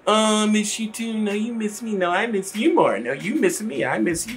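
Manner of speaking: high, mushy lovebird phone voice; mock the couple cooing goodbye; giggly late night call. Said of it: "Boo-hooing, loving".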